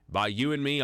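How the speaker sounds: normal talking voice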